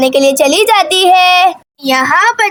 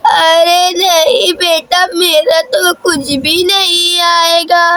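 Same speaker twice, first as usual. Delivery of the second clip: slow, drawn-out grandmother voice; elderly grandmother; slower than usual. Acted. Grandmother telling her child she needs nothing from the market, slow elderly voice. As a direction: stretch the words out like an old granny.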